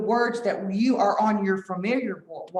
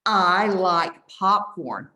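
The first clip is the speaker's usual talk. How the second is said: very slow, louder, clearly spaced; slow repeat-after-me model; 3 semitones lower, 5 dB louder, much slower than usual. Shown as a demonstration. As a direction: stretch each word so the children can echo. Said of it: "I'll say it and you repeat it".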